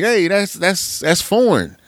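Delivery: a small dog startled by the doorbell; little dog voice. Retold or imitated. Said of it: "when they hear the doorbell ring".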